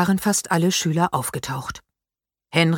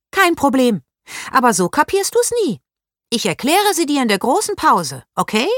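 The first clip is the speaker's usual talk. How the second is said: raised, lively schoolgirl voice; a helpful, know-it-all classmate; the clever girl offering to explain; bright girl Paula; 8 semitones higher, 3 dB louder than usual. A narrator's reading.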